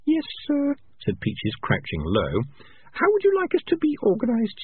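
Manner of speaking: put-on high, earnest young female rat; Peaches' voice. A narrator's reading. Peaches telling the clan leader, high young rat voice. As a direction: pitch it up for the clever young rat.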